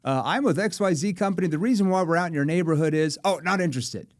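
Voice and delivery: scripted door-to-door salesman; door pitch. Retold or imitated. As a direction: rattle off the script.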